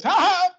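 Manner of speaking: high, loud acted "ha ha"; mock triumphant laugh. Lex cutting in on Superman's plan, mock triumphant laugh. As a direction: burst out with a villain's gotcha laugh. Acting Lex's sudden outburst.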